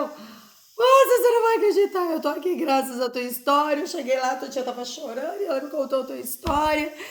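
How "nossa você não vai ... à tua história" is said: high, loud gasp of amazement with a laugh; stunned, laughing disbelief, pitched high and loud; amazed exclamation. Retold or imitated. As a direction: burst out in astonished delight. Stranger amazed at coincidence.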